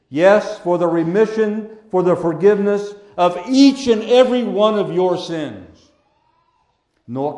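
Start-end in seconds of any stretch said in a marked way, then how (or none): none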